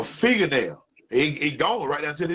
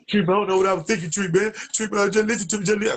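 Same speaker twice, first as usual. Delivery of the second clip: begging friend; 8 semitones higher, faster than usual. Retold or imitated. Friend on the phone begging Tree, high and pleading.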